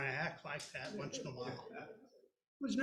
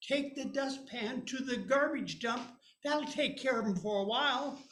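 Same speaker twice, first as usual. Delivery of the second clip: sister bossing; 6 semitones higher, 5 dB louder than usual. Retold or imitated. Sister telling mom to give him a chore. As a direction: push the chore onto little brother. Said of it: "my blister yells out".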